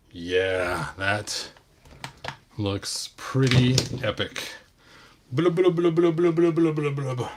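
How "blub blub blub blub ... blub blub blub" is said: high gurgly fish bubbling; gurgling fish man croak. Pretend. Toy fish figure voiced in play.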